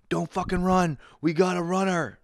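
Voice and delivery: high, pushed yell of a pool lifeguard; mock lifeguard yell. Lifeguard spots a kid running, yells high and pushed. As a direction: bark at the kid like a lifeguard spotting a runner.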